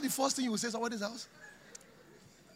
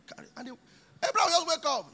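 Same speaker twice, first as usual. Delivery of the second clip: ordinary talk, nothing marked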